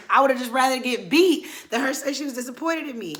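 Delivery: high, loud mock lament; anguished protest. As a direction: wail it as the worse punishment. Emotional exclamation about mom's disappointment.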